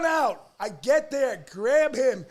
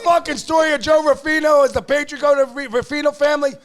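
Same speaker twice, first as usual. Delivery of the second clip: loud angry rant, higher pitched; 4 semitones higher, 8 dB louder than usual. Real, not acted. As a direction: tear into the critic. Ranting at critic online.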